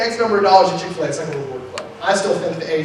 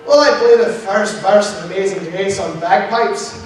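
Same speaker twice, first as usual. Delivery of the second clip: hammed-up Scottish missionary; mock Scottish accent; 3 dB louder than usual. Scottish missionary explaining his bagpipe calling, mock Scottish accent. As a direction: put on a lively Scottish accent. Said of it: "talked to a lot of Scottish missionaries".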